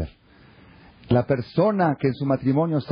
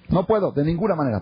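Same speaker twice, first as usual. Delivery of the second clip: higher-pitched, emphatic imitated refusal; adamant refusal; 7 semitones higher than usual. Brother refusing the rabbi's plea about the wedding, adamant. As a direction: refuse outright, pitch jumping up.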